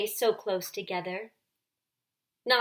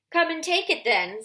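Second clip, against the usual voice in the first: loud, higher bellowing challenge; 8 semitones higher, 6 dB louder than usual. A narrator's reading. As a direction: roar the dare at the witch. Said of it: "in a great bellowing voice".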